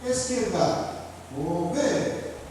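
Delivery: "Esquerda" chamada, "Vou" alongado até soltar "ver"; comando completo, tônica no V. Shown as a demonstration. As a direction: segure "Vou" e detone em "ver". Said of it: "quando você dá a sílaba tônica". (voz de comando, "ver" final acentuado)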